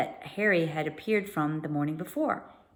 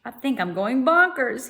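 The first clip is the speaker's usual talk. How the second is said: usual speaking voice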